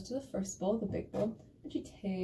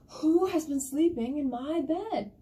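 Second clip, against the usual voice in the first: loud, slow, indignant big bear, pitched up; 6 semitones higher, 6 dB louder, slower than usual. Acted. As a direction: boom out the bear's outraged question, drawn out. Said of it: "And the big bear goes".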